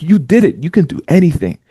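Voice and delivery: praising. Retold or imitated. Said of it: "I'm like".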